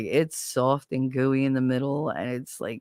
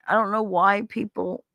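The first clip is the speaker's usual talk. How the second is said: normal talking voice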